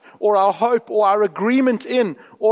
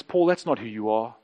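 low, soft reassurance; quiet, tender word from God; gracious reminder; 11 semitones lower, 5 dB quieter than usual. Retold or imitated. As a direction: gently correct him, voice dropped. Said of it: "God reminds us graciously".

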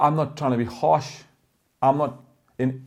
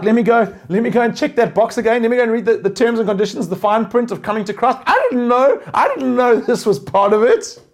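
mock surprise; 7 semitones higher, 5 dB louder than usual. Mock believer surprised by trials.

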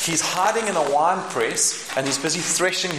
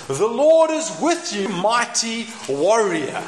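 slow, booming, higher angel proclamation; grand angel voice; 6 semitones higher, 3 dB louder, slower than usual. Acted. Angel hailing Gideon, slow and grand. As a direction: proclaim it like a heavenly messenger.

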